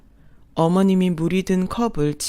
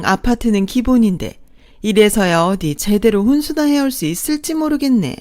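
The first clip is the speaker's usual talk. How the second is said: higher, louder mocking voice of a snobby stepmother-in-law; second mother-in-law belittling the bride's parents, sneering; 4 semitones higher, 4 dB louder than usual. A narrator's reading. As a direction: look down your nose and jeer. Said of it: "더 가관인 건 둘째 어머니였어요".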